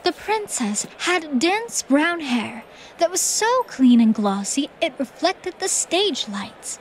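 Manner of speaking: usual speaking voice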